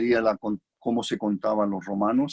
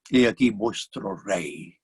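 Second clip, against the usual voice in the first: Pilate, wry presentation; 3 semitones lower, slower than usual. Acted. Pilate shows a beaten man to the crowd, slow wry presentation. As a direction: offer him up as an unlikely king.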